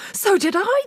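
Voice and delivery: put-on high, loud startled boy; agreeing in fright; alarmed brother. A narrator's reading. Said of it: "the brother said".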